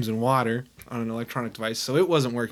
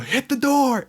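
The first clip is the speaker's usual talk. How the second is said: calling out; 13 semitones higher, 5 dB louder, slower than usual. Retold or imitated. Calling out to friends.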